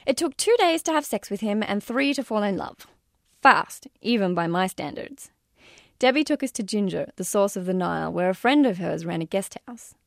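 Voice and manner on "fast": ordinary delivery, nothing marked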